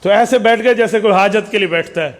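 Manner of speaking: ordinary talk, nothing marked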